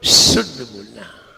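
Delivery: शुद्ध opening on a strong hissed "sh"; whistling sibilant. Shown as a demonstration. Teacher has students listen to the sound, whistling शुद्ध. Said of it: "आवाज देखिए".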